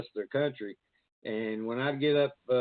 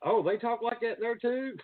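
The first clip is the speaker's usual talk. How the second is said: imitating outsiders; 10 semitones higher than usual. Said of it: "retold outsider reply".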